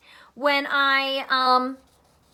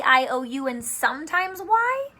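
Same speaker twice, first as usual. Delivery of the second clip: usual speaking voice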